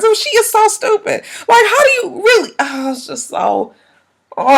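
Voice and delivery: high, cry-laughing disbelief; exasperated laughing. Real, not acted. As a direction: laugh helplessly at how dumb it is.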